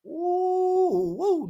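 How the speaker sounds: high, drawn-out teasing ooh; mocking hoot. Real, not acted. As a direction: hoot at the cap. Reacting to an obvious lie.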